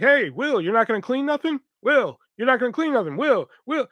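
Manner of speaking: high, pestering imitation of a coworker; mock nagging. Mimics coworker nagging him.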